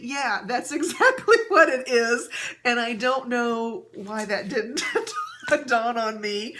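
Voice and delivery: laughing. Real, not acted. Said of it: "I laughed so hard".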